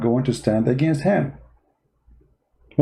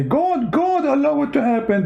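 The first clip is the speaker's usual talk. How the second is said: much higher, mocking voice; mocking believer; 12 semitones higher than usual. Mocking the caller's belief, in a much higher mocking voice. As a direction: squeak it out like a naive believer parroting. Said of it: "you should say".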